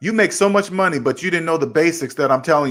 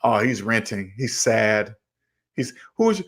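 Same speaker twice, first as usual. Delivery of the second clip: lower, softer mock pity for the renter; pitying onlooker; 6 semitones lower, 4 dB quieter than usual. People pitying a renter, in a lower, softer mock voice. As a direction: lightly voice a pitying neighbour. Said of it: "They go".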